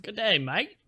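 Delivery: high, rising Aussie greeting; put-on Aussie twang; mock Australian accent. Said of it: "I love that accent".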